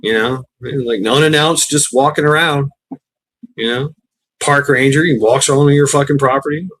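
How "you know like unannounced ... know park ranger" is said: usual speaking voice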